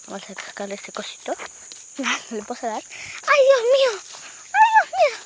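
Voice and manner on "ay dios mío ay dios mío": high alarmed cry. Real, not acted. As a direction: squeal it in alarm. Exclaims in alarm.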